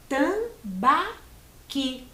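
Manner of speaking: Tambaqui split as TAM-BA-QUI, very slow; syllable by syllable. Shown as a demonstration. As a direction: count out Tambaqui with pauses between syllables. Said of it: "Três sílabas". (Tambaqui separado em três sílabas, bem devagar)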